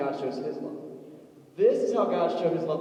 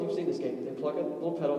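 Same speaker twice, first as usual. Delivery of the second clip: usual speaking voice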